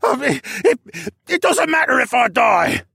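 stammering start into a high, loud shriek; frantic, high-pitched outburst; panicked shriek. A narrator's reading. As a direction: shriek it like a terrified captive. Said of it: "Luca shrieked".

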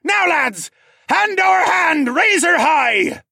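high, loud rallying cry; full-force captain's hauling shout; shouted orders. A narrator's reading. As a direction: drive the crew on, drawing out the end. Pirate captain urging crew to haul.

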